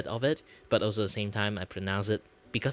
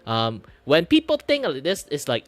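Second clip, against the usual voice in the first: loud, high Malaysian English; broad Uncle Roger-style Malaysian accent; 7 semitones higher, 8 dB louder than usual. Shown as a demonstration. Explaining how lots of Malaysians sound, broad Malaysian English. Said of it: "a lot of Malaysians sound like this".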